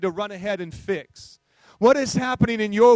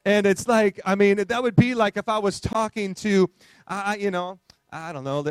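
ordinary delivery

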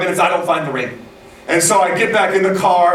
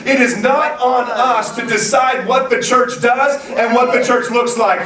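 raised, driving sermon delivery; preaching; 5 semitones higher than usual. Acted. Pastor calling the congregation to listen to God, preaching. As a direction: press the point home to the church.

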